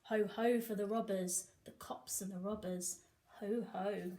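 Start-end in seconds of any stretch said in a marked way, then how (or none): none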